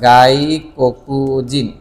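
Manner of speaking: drawn-out sounding out. Shown as a demonstration. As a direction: sound it out slowly while typing. Sounds out word while typing a search.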